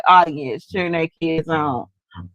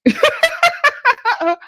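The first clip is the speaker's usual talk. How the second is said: high burst of real laughter. Real, not acted. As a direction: break up laughing. Laughs while asking for backup.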